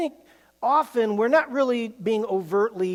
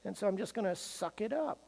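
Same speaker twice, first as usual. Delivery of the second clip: fearful thought; 5 semitones higher, 11 dB quieter than usual. Retold or imitated. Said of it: "out of self-protecting fear".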